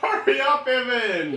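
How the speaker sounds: high, loud call. Real, not acted. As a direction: shout for him to hurry up. Calling out to a friend.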